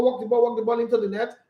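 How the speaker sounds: ordinary talk, nothing marked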